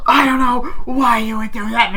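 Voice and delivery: high, loud mock scream; imitated screaming. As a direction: act out a screaming family row. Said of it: "they're screaming like".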